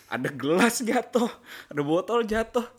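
normal talking voice

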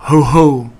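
Heffalump voice. A narrator's reading. Pooh imagining the Heffalump's next greeting, booming. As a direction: boom it out like an imagined beast. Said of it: "the sort of voice this Heffalump was going to say it in".

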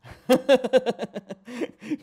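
high bursting laugh; loud laugh. Real, not acted. Laughs at own rough habit.

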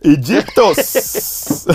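high, slow, stretched shout of Egyptos; drawn-out exclamation. Real, not acted. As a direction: stretch the word out in delight. Exclaims place name playfully.

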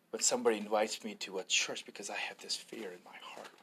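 hushed, whispered confession; retold whisper. Retelling a hesitant man's fear of church, whispered. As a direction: whisper it like a fearful secret.

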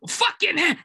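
a sudden high, furious snap; angry outburst. Retold or imitated. Father about to blow up at the caller, angry outburst. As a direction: start the word in rage. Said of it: "he's like".

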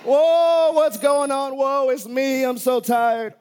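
mock sleepy grumble about Mondays; groggy complaining. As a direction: moan like someone who can't get up on Monday. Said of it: "stay in bed going".

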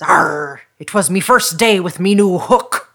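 loud pirate growl with a long arr. Acted. Pirate explaining his eye to little boy, gruffly hearty. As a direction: land the punchline in a hearty sea-dog voice. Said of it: "And the pirate says".

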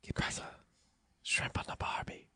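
Shrimp on the Barbie, breathed in an Australian whisper; showing friends a whispered Australian accent. Shown as a demonstration. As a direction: hush the Aussie line out without voice. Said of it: "That's the way I whispered it".

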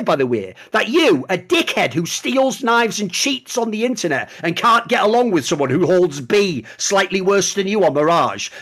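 usual speaking voice

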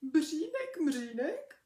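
ordinary delivery, nothing marked

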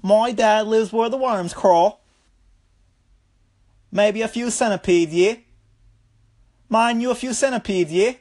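mock-eerie boy with a questioning "yeah?"; creepy Australian kid. Kid describes where his dad lives, creepy Australian imitation. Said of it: "he just sounds so fucking creepy".

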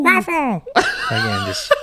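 high laughing words. Real, not acted. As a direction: laugh as you speak. Laughing at the impression.

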